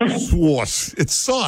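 "Sauce" drawn out into three syllables; mock three-syllable sauce. Shown as a demonstration. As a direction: stretch "sauce" to mock her. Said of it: "three syllables in there".

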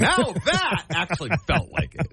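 chuckling through the words; a real amused laugh mid-sentence. Real, not acted. Ex-player laughing with the host about his coach's line, amused.

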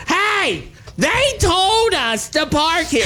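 high and slow, each syllable jabbed out; indignant pointed retort. Retold or imitated. Repeating his reply to the smokers, high and indignant. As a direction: scold like wagging a finger at them. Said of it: "I moved my index finger up and down with every syllable".

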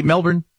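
Melbourne said MEL-bn, the ending swallowed; Melbourne as locals say it, with a short unstressed end; Aussie Melbourne. Shown as a demonstration. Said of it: "not Melbourne".

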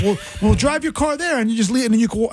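lightly voiced chatbot, humouring a confused user; ChatGPT reply. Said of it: "Chat G P T is like".

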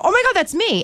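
surprised squeal. Retold or imitated. Retelling her own surprised reaction.

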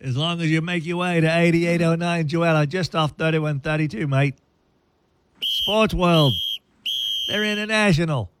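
sponsor spot in a hammed-up Aussie voice; chirpy Australian huckster, mate at every turn; Australian accent. Radio host reads the Sports World ad in Australian accent. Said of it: "I didn't know he had an Australian".

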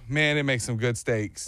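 ordinary talk, nothing marked

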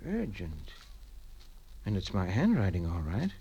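low, soft puzzled murmur; musing to himself. Acted. Father alone puzzles over his own letter, quiet musing. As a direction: think aloud under your breath, pitched low.

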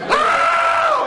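very high, loud oh; alarmed cry. Retold or imitated. Teller reliving the coon jumping in the pickup, high loud yelp. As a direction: yelp in the chaos. Chaos in the truck.

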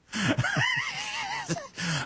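shrieking burst of laughter; sudden high laughing shout. Real, not acted. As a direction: let a big high laugh out. Host cracks up at own joke.

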